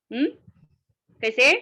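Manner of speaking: ordinary talk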